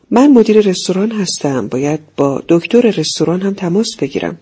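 ordinary delivery, nothing marked

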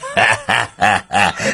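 scornful laughter; mocking laugh. A narrator's reading. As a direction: laugh in mockery at them. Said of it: "با خندهٔ تمسخرآمیزی".

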